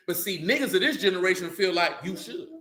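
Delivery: ordinary delivery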